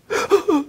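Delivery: high broken crying gasps; imitated sobbing gasps. Confused girl pausing in her crying, mock sobbing gasps. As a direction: sob in little catches, with breaks. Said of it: "to make breaks among the crying".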